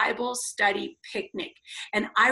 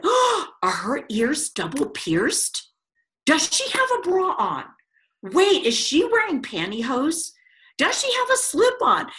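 judgy church mamas, climbing higher; mock gossip; 6 semitones higher, 3 dB louder than usual. Church mamas eyeing the newcomer, scandalized gossip. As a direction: pile up the questions, scandalized.

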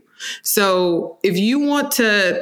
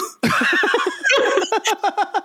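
a burst of high, bright laughter; laughing; 14 semitones higher than usual. Real, not acted. Laughing while telling her podcast host the mentor story.

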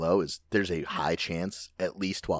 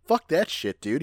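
buddy's scoffing advice; 7 semitones higher, slower than usual. Retold or imitated. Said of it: "he's like".

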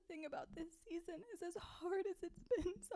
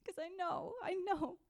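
ordinary delivery

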